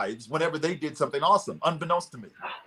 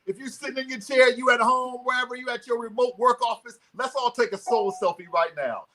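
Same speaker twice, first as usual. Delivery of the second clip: raised, energetic coaching voice; motivational speaker guiding; 7 semitones higher than usual. Acted. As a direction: lead the whole room to their feet. Leads audience in soul selfie.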